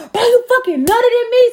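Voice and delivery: high, loud retold outburst; panicked accusation. Retelling her confronting the man, panicked accusation.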